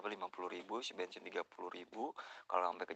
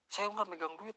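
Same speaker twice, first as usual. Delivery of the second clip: a higher, louder imitation of the cashier; imitating the cashier; 11 semitones higher, 4 dB louder than usual. Retold cashier insisting to the customer she has no money, pitched up. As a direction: mimic the defensive cashier, pitched up. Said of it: "langsung gini".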